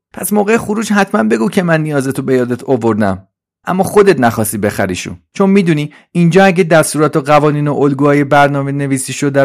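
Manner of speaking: high, loud, anxious helper voice; Trolley, worried. Acted. Trolley warns Suzi about being wiped, high anxious voice.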